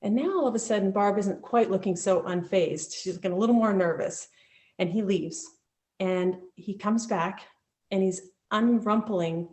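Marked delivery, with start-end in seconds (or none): none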